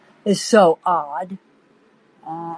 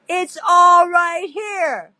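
insisting; 9 semitones higher, slower than usual. Retold or imitated. Insisting the recording exists.

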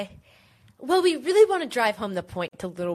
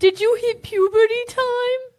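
put-on very high, loud child character; high cartoon kid; 8 dB louder than usual. Friend quizzes changed Time, high cartoon voice. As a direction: pipe it out in a squeaky little voice. Acts a young friend in the film.

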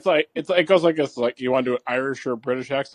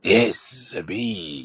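put-on Spanish accent; 7 semitones lower, much slower than usual. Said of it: "getting out of Spanish now".

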